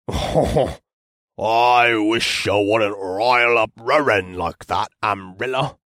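gruff voice. Acted. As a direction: rumble it like a stout smith. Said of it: "in a gruff voice".

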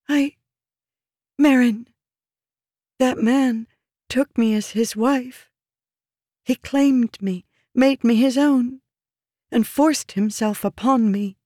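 high, distressed woman with halting pauses; pleading woman. Acted. Woman telling her protector of the king's abuse, distressed.

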